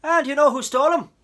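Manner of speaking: big loud officer's voice, humoring; gruff, amused policeman. A narrator's reading. Policeman asks Coraline who stole her parents, loud, amused. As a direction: question a child with a smile in the voice. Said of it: "asked the police officer".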